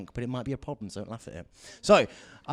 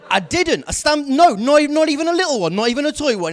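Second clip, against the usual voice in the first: much higher, louder, laughing denial; emphatic denial; 14 semitones higher, 12 dB louder than usual. Real, not acted. Comic insisting to the audience he had no gun, in a loud laughing denial. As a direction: insist hard to the crowd that there was no gun.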